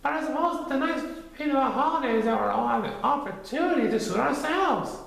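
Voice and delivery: put-on quick bright boy voice; high, fast Christopher Robin. A narrator's reading. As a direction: rattle off the thought.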